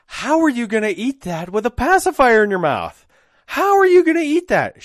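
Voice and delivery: high, loud, hammed-up astonishment; mock-amazed parent. Parent hamming up the joke for a toddler, loud mock amazement. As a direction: play it huge for a toddler, mock-baffled.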